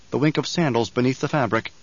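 plain reading voice

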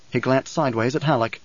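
ordinary delivery, nothing marked

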